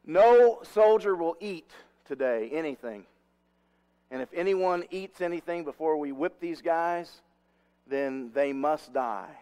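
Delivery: emphatic royal order, slowing to the final threat; rash decree. Acted. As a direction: proclaim it like a rash king laying down law. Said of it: "in his rashness, he said".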